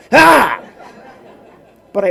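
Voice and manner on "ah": loud, high comic shriek; one sudden hammed-up cry, pitched way up; imitated clerk's outburst; mock yelp. Clerk one-ups him with noise.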